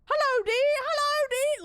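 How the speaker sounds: high, loud hammed-up fake Australian accent; imitating an American hamming up an Aussie accent; mock Aussie accent. Mimicking a store guy doing a hammed-up Aussie accent, high and loud. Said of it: "Chris's version of an Aussie accent".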